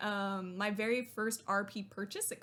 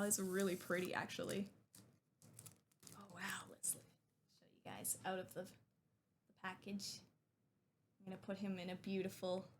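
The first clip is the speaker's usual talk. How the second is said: usual speaking voice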